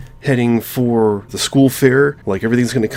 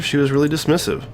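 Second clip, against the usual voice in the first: ordinary talk, nothing marked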